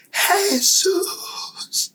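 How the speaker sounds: high, ringing cry of the name; crowd cry. Retold or imitated. Forty-five thousand men crying out together, high. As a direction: call it out as one voice. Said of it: "todos gritaron".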